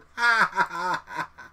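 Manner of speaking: real, open belly laugh in bursts; amused cackle in short bursts; burst of laughter. Real, not acted. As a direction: laugh out loud at what was just heard. Laughs at the voice sample.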